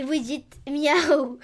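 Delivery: slow stretched miaou; drawn out. Shown as a demonstration. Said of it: "drawn out call to chat".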